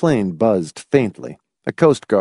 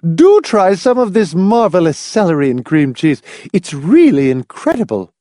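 put-on high, louder gushing old lady; gushing hostess; 7 semitones higher, 5 dB louder than usual. A narrator's reading. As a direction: Mrs Pritchett offers celery, high and gushing. Old lady pushes food.

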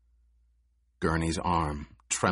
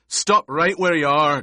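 snapped command, much higher and louder; sharp snap; 8 semitones higher, 7 dB louder than usual. A narrator's reading. Gurney snapping at Paul to stop. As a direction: bark it to freeze him in place. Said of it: "Gurney snapped".

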